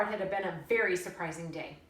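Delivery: plain reading voice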